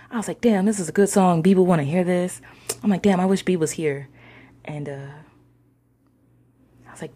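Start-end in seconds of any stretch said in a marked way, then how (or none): 0.4-4.1 loud, higher, caught up in the song; vibing. Retold or imitated. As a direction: exclaim it like you're loving the music. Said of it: "I was vibing".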